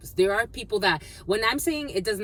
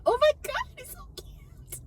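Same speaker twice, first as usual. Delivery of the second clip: very high cooing squeal, trailing to almost nothing; delighted squeal. Real, not acted. A tarot reader squeals at a butterfly floating past. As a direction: gush over something adorable in a squeaky voice.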